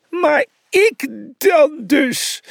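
high, drawn-out forlorn whine; sad sniffling. A narrator's reading. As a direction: whimper it slowly, high-pitched and hurt. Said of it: "snufte Brutus verdrietig".

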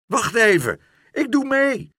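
put-on like an excited boy joining in; gleeful park keeper. The park keeper calls to the workmen that he joins in, high and gleeful. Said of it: "riep hij".

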